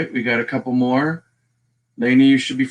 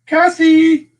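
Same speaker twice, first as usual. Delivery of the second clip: high, loud greeting shout; high-pitched call to a friend; 7 dB louder than usual. Real, not acted. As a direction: holler the name in delight. Calling out a viewer's name.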